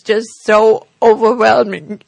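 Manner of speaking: teary, voice welling up; choked up with real emotion; after crying. Real, not acted. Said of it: "sound very teary".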